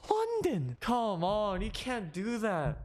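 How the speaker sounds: shouted high with mock outrage; incredulous yelp; exclaimed in disbelief. Real, not acted. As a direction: blurt the name out in disbelief. Mocking reaction to the name.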